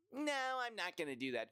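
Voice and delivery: high mocking whine of a complainer. Complainer brushing off advice, high mocking whine. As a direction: sneer it like a complainer you despise.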